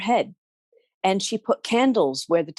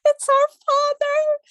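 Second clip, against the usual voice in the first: high, slow teary voice; crying daughter's plea; weeping daughter; 4 dB louder than usual. A narrator's reading. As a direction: whimper like a crying girl. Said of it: "says one of the daughters".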